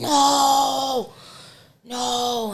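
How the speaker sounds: loud, high desperate cry; retold panicked scream. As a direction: yell it as you watch your body. Retold screaming at own body.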